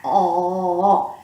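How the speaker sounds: pressed-down larynx, dark oh; tongue back, low swallowed oh; swallowed dark vowels. Shown as a demonstration. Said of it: "then you pull your tongue back".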